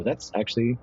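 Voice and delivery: usual speaking voice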